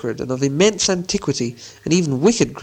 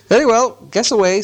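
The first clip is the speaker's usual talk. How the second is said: high, loud, ready reply; brisk challenge; 8 semitones higher, 4 dB louder than usual. A narrator's reading. Bilbo lets Gollum guess, boldly. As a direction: call it out higher and louder.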